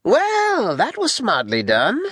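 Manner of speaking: high, lordly voice with swooping pitch; high-pitched warlord. Acted. Newcomer remarks on the fallen captain, high-pitched. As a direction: pitch it high, like a grating little conqueror. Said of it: "He has a high-pitched voice".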